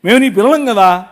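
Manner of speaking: loud, ringing sermon delivery; full-force preaching. Acted. Pastor telling the congregation Habakkuk's story, loud preaching. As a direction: proclaim it to the whole hall.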